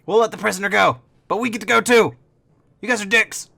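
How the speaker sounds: high, loud kobold conceding with a sulk; grudging kobold. Acted. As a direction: give up the prisoner, resentful and shrill-pitched. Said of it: "And he goes".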